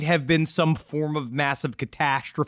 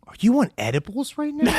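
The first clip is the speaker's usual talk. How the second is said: incredulous; 7 semitones higher than usual. Real, not acted. Incredulous teasing question.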